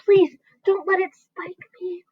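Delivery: high, slow pleading plea; frightened earthworm pleading; begging. A narrator's reading. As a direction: beg not to get speared. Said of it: "begged the earthworm".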